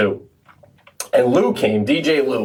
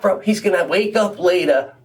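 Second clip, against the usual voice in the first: high-pitched, exasperated listing of each leg; comic disbelief, pitched well above normal talk; incredulous rant; 8 semitones higher than usual. Real, not acted. Mocking friend's absurd journey home.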